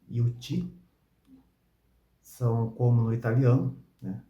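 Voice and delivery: ordinary delivery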